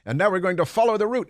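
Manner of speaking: put-on quick, excited French-accented driver. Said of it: "very thick French accent he had".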